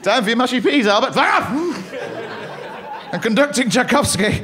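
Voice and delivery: care home scene. Retold or imitated. Nurse and old man in care home, mock elderly voice. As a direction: play the day-room pensioner conducting.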